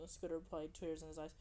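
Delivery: usual speaking voice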